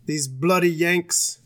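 slow, lightly put-on British grumble; a hint of a resentful English fan; mock British accent, slow. Voicing English team.

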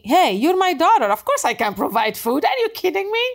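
playful high-pitched God, amused and incredulous; hammed-up divine "are you kidding" voice; imitating God. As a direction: scoff fondly as a heavenly father, pitch leaping up. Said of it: "as if he, he was telling me".

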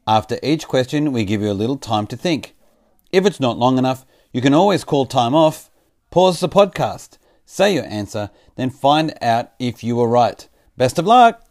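loud, high, slow announcer voice; quiz show announcer. Acted. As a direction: boom it out like a game show host.

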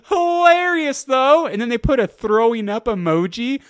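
high and loud, still laughing; bubbling over with amusement; excited giddy telling. Real, not acted. Excited retelling while laughing.

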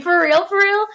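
high, excited double-check; excited disbelief. Retold or imitated. Applicant hearing of a job offer, excited disbelief. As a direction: blurt it high, hardly believing it.

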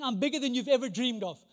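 usual speaking voice

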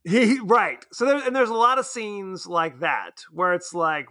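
ordinary delivery

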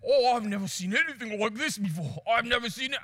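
Australian-sounding attempt at South African; botched South African accent. Amazed guide at the lion fight, Australian-sounding South African accent. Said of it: "I just sounded like Steve Irwin there".

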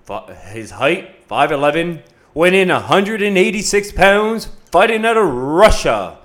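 drawn-out fight introduction; boxing ring announcer. Acted. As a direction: build to the name, slowly.

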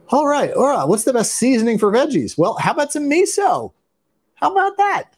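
high, playful smart-speaker voice; talking to a voice assistant. Pretend. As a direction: ask and answer like a gadget.

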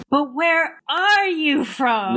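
high, very slow, drawn-out imitation; mock insistent question. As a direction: stretch out ARE, mimicking her persistence. Lady asks the offending question again.

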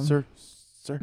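timid, high little calls, reenacted; scared. Touching injured man's shoulder, scared. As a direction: gingerly rouse someone you dread touching. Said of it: "scared to touch".

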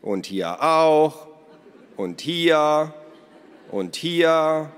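loud, high, drawn-out holler; calling from outside. A narrator's reading. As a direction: keep shouting reports from the garden. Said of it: "von draußen rufen".

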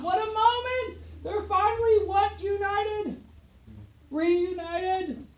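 thrilled storyteller at the lovers' reunion; excited reunion. Acted. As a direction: sweep the class up in the joy.

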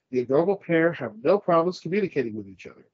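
usual speaking voice

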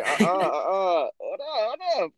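high, panicky pleading; mock panicked. Scared on merry-go-round, quoted.

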